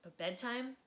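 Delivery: expressive read-aloud storytelling; lively children's book narrator; story narration. A narrator's reading. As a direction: read it like bedtime story time for kids. Reading a picture book aloud.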